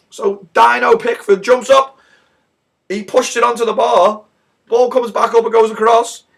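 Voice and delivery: high, loud build-up; excited retelling. Retold or imitated. Fan retelling ball hitting the bar, high excited shouting. Climax retold in squawk.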